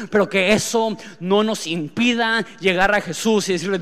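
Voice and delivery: ordinary delivery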